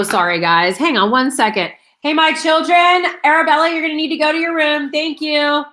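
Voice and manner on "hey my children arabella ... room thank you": higher-pitched parental call, quick instruction. Real, not acted. Calls away to her children.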